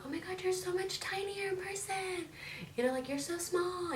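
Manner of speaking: imitating gushing people. Imitating people who meet her in person, gushing high. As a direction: squeal it like an excited fan. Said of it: "people do tell me".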